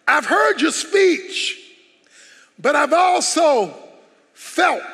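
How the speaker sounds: slow, stretched phrases climbing to full preaching force; building sermon climax. Acted. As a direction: preach it, building with each line.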